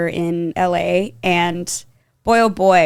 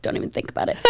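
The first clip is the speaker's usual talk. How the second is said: whispered, much softer threat; hushed mock warning; 11 dB quieter, much faster than usual. Friend warning her off Pop Chips, mock-menacing whisper. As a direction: warn under your breath, in jest.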